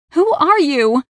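high, loud cry; desperate demand. A narrator's reading. Raya facing death at a stranger's hands, desperate shout. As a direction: cry out frantically for an answer. Desperate question during deadly countdown.

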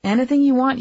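usual speaking voice

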